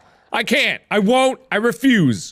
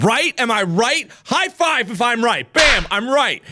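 ordinary talk

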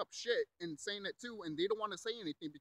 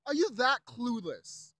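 loud, slow, higher-pitched disbelief; exasperated rebuke; 4 semitones higher, 6 dB louder, slower than usual. Real, not acted. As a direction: hammer it out slowly in real exasperation. Angry rebuke after mocking them.

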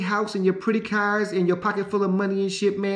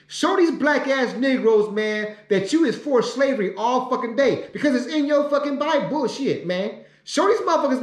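mock prayer; 2 semitones higher than usual. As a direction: call on God, rising with each demand. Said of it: "This is what I just prayed for".

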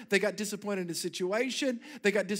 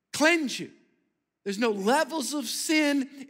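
preaching; 3 semitones higher, 3 dB louder than usual. Acted. Preaching builds in force.

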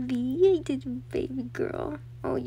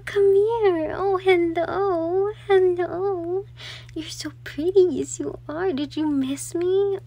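cooing pet talk; 7 semitones higher, 9 dB louder, slower than usual. Real, not acted. Cooing to her excited pet.

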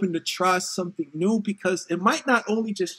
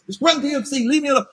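annoyed mother; 7 semitones higher, faster than usual. Retold or imitated. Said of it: "So the mother goes".

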